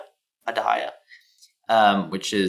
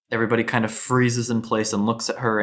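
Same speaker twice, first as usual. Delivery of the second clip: usual speaking voice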